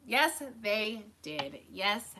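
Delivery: slow, emphatic agreement; knowing, stretched reply; slow knowing drawl. Real, not acted. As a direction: draw it out slowly.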